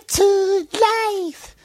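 high, slow falsetto line. Retold or imitated. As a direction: sing it in falsetto. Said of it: "I can't do a falsetto".

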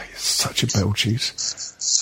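slow, breathless whispered put-down; whispered insult. Real, not acted. Host mutters an insult at his co-host in a slow whisper. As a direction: sigh it out in a whisper, slowly. Exasperated insult after a sigh.

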